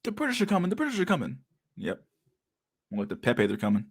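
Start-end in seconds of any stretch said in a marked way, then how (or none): 0.0-1.4 high-pitched, softer comic warning; high mimicked call of alarm; mock alarm cry. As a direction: imitate a town crier in a small high voice.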